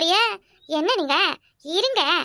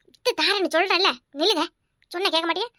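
ordinary delivery

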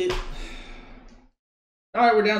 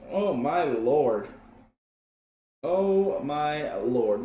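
slow dismay; 5 semitones lower, much slower than usual. Real, not acted.

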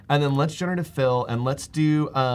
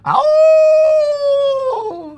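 long high howling ow; wolf howl; 11 dB louder than usual. Pretend. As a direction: throw your head back and howl like a wolf. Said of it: "give us your best wolf howl".

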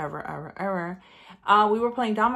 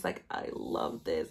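whispered delight; 7 dB quieter, slower than usual. Real, not acted. Admiring the palette.

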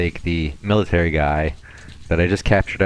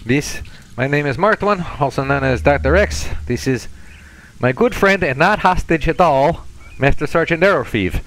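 hammed-up Russian introducing himself to a new acquaintance; thick mock-Russian greeting, raised and emphatic; fake Russian accent; 7 semitones higher, 4 dB louder than usual. Introducing himself and his "not hostage" friend, fake Russian accent. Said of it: "terrible fake Russian accent a little thick".

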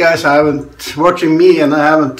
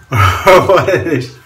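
laughing; 3 semitones higher, 4 dB louder, slower than usual. Real, not acted. Unsure of word, laughs.

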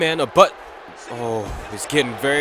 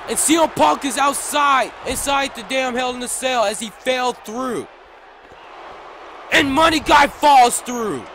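high, loud ringside call; excited shouting; 11 semitones higher, 10 dB louder than usual. Real, not acted. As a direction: shout the finisher out. Big move called out.

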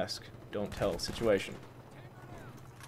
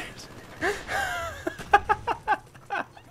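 helpless high wheezing laugh; shrill crying laugh. Real, not acted. Streamer unable to stop laughing at campers, shrill laugh. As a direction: laugh until it squeaks. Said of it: "I can't stop laughing".

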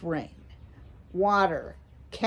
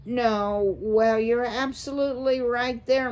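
strained cheerful, a little higher; nervous mouse forcing a bright tone; forced jauntiness; 6 semitones higher, 3 dB louder than usual. Acted. A nervous father mouse humoring the owl, forced jaunty. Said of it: "straining to sound jaunty".

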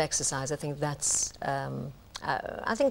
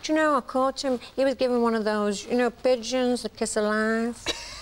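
higher, broad northern barmaid voice; chatty pub landlady, pitched up; Coronation Street impression; 6 semitones higher than usual. Asked who she loves impersonating, northern soap voice. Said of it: "who you enjoy impersonating the most".

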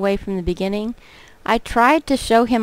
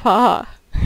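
nervous laugh. Acted. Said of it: "It's a nervous laugh".

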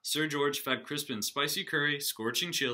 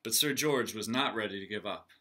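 lively read-aloud for the class; storybook reading; 5 dB louder than usual. A narrator's reading. Teacher reading a picture book aloud to her class. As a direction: read it like story time for kids.

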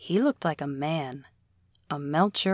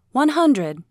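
higher, loud call across crowd; calling out a bid; 9 semitones higher, 8 dB louder than usual. Acted. Woman bidding from crowd.

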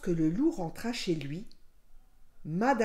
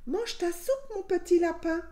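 high-pitched mother voice; maman rabbit; 9 semitones higher than usual. A narrator's reading. Maman tells her little rabbit to eat, high motherly voice. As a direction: call it out high, coaxing the child.

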